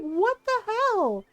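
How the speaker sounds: high, slow, appalled stepmom; imitated outrage. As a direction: draw it out in scandalized dismay.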